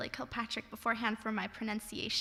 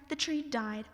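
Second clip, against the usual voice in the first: usual speaking voice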